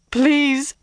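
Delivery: high-pitched, desperate begging; sobbing plea. A narrator's reading. Captive seamstress begging her interrogators, sobbing plea. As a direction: plead on the edge of sobs. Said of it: "Suddenly she was sobbing".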